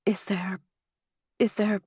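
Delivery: soft halting murmur, first words whispered; whispered stammer. A narrator's reading. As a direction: murmur it in numb shock, breaking off. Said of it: "I murmured".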